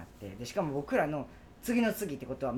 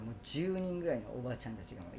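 usual speaking voice

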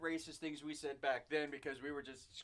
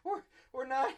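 laughing; 6 semitones higher, 5 dB louder than usual. Retold or imitated. Recalling uncontrollable laughter.

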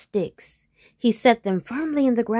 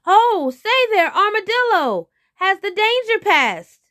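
calling out; 12 semitones higher, 7 dB louder than usual. Acted. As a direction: hail a passer-by as the trapped tiger. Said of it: "the tiger called out".

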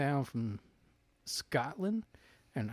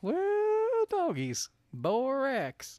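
high hillbilly "Well, doggies"; hillbilly accent; 12 semitones higher, 3 dB louder, much slower than usual. Pretend. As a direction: holler it like a mountain man. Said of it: "I just did well doggies".

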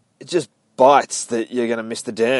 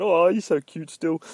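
put-on higher baby-talk coo to a mangy dog; 8 semitones higher than usual. Reader coos at the feral dog in the comic, high baby talk. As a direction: coo at it like a cute puppy. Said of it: "I'm just looking at him going".

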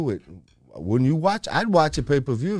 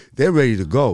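loud gung-ho soldier; 7 dB louder than usual. Real, not acted. Hyping soldiers ready for war.

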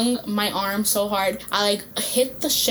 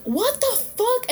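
high, slow mock outrage; imitating a furious boyfriend; furious yell; 12 semitones higher, slower than usual. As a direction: retell his angry shout, pitched way up.

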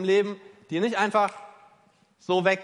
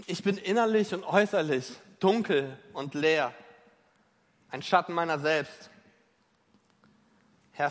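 lament prayer. Acted. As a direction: pour out the lament like a psalm. Acted lament prayer, psalm voiced.

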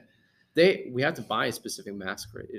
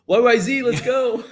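excited callout; 12 semitones higher, 10 dB louder, slower than usual. Real, not acted. Hyped song callout.

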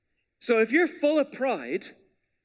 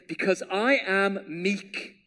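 slow emphasis; slower than usual. Shown as a demonstration. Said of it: "here's this word again".

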